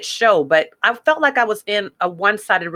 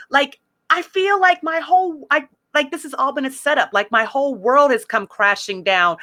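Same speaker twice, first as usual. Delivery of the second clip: high-pitched indignant rant; angry venting; 7 semitones higher than usual. Retold or imitated. Recounting her breakup with God, high indignant ranting. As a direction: pour out the betrayal, voice up high.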